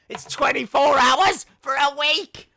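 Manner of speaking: loud, high, slow wailing; half-laughing hysterical wail; hysterical outcry. Real, not acted. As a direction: lose it in mock despair. Mock hysteria.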